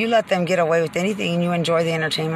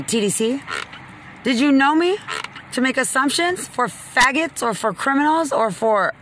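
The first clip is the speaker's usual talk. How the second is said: raised, higher angry voice; heated rant; 6 semitones higher than usual. Real, not acted. Ranting at the sheriff's department and TDC, angry and raised. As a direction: voice climbing as the anger boils over.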